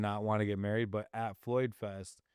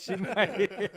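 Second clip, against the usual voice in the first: a higher, laughing repeat of the tease; 9 semitones higher, 3 dB louder than usual. Real, not acted. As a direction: laugh it through, teasing.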